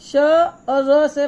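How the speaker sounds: very slowly, letter by letter; slow spelling-out. Shown as a demonstration. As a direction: break श्र into श and र for the class. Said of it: "फिर श्र देखिए". (धीरे-धीरे अक्षर अलग करके)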